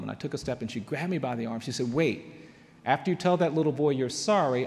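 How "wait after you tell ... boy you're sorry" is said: imitated firm, louder maternal order; commanding mother. Mother grabs her son by the arm, firm command. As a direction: stop the child and lay down instructions.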